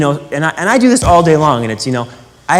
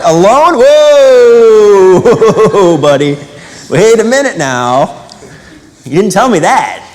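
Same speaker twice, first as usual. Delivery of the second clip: mock balk; 6 semitones higher, 7 dB louder, slower than usual. Crowd balks at the fine print.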